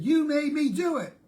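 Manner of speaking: put-on high, loud accusing child; child's high, loud accusing cry; angry child accusation. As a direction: point the finger and blame hard. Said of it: "with a lot of anger and real conviction".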